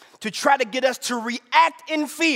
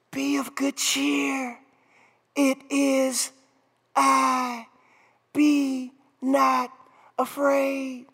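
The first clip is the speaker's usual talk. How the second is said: slow, hushed words with long pauses; hushed and unhurried, spaced out; soft whispering voice; 5 dB quieter, much slower than usual. Acted. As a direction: speak as a still, soft voice.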